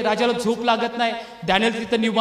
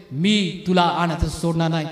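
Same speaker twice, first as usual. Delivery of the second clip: lower, slow solemn promise; God's voice; 6 semitones lower than usual. Retold or imitated. God promising the faithful not to leave them, low and slow. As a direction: speak it as God, slowly and deep.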